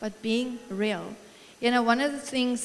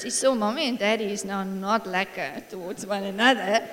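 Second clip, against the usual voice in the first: ordinary delivery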